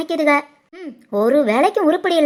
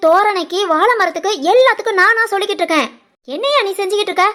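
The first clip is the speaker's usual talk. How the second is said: fast, higher scolding rant; quick, pitched-up nagging at her husband; nagging shout; 3 semitones higher than usual. Acted. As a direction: rattle off complaints at the husband, fast. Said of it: "லூசு மாதிரி கத்தாத".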